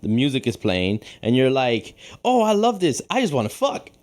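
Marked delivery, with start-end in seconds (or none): 2.2-3.9 higher-pitched mock kid's glee; gleeful seven-year-old. Teasing friend as seven-year-old at music, mock glee. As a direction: gush like an overexcited child, laughing. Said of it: "You're seven years old".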